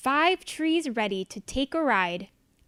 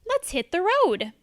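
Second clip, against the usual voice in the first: eager; 6 semitones higher, 5 dB louder than usual. Acted. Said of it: "says Blue".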